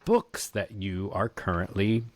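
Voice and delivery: ordinary talk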